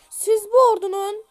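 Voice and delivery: loud, high battle speech to the troops; rally the army like a proud general; rousing commander speech. Pretend. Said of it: "aşırı gururlandırıcı konuşuyorum". (coşkulu komutan nutku)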